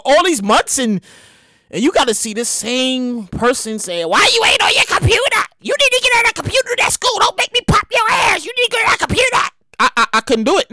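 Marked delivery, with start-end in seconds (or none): 4.0-9.4 scolding parent. Retold or imitated. Parent yelling at a teen about online school, high shrill scold. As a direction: nag the kid like a fed-up parent.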